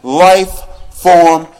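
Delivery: rhythmic, rising poetry slam delivery over a beat; charged spoken word, riding the music; spoken word performance. Acted. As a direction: perform it like a slam poet owning the stage.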